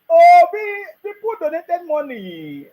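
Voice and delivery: high, loud wail, drawn out at the end; mock lament. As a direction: cry it out in mock outrage. Mocking the donations to Obi.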